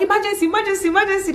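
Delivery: high, urgent repeated call. Retold or imitated. Parents arriving at the hospital, calling out high and urgent. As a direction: call for help as you rush in. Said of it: "we were like".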